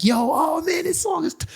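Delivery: high-pitched mock gushing; fake fan. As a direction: imitate a sudden fan, voice way up. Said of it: "people wanna act like".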